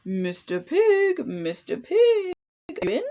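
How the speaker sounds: sing-song knock-knock call to a pig inside; calling at the door. Acted. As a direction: call sweetly through the door, stretching each word. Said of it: "I knocked and I called".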